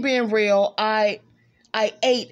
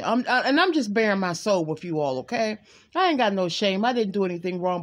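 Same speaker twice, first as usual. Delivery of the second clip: normal talking voice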